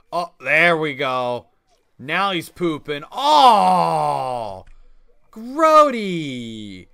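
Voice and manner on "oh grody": loud disgusted groan; grossed out. Real, not acted. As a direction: react to something gross. Dog pooping on screen.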